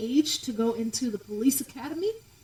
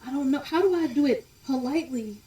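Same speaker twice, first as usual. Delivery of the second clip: ordinary delivery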